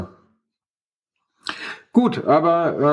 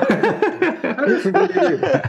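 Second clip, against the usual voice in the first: high, slow, giggling words; laughing; slower than usual. Real, not acted. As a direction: squeak it out mid-laugh. Group laughing fit.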